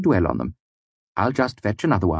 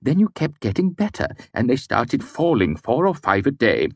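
rising higher, ever faster anxious confession; words tumbling faster; 4 semitones higher than usual. A narrator's reading. Servant confesses to his master, tumbling faster and higher. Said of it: "his words came faster and faster".

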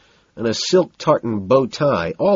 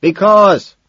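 high, loud yell; raised, full-voiced shout; scream over music; 10 semitones higher, 9 dB louder than usual. A narrator's reading. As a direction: yell back over the club noise. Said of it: "he screams over the music".

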